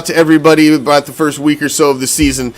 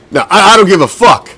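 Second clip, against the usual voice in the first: ordinary talk, nothing marked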